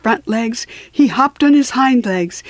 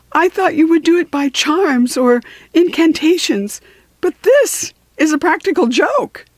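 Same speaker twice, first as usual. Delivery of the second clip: high, indignant complaint; kangaroo protesting; 8 semitones higher than usual. A narrator's reading. Old kangaroo accuses Quong of a practical joke, indignant. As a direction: squeak out the grievance. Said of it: "said Kangaroo".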